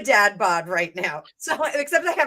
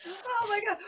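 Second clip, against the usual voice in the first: laughing; 8 semitones higher, 8 dB quieter than usual. Real, not acted. Winding down from laughing.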